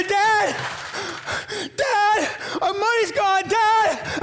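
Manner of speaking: high, loud, tearful calling; crying out for dad. Retold or imitated. Boy discovers the stolen money and calls his dad, crying and shouting. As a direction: shout for your dad through tears.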